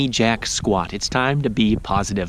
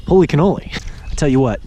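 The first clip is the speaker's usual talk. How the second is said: usual speaking voice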